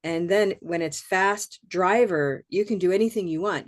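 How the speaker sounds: normal talking voice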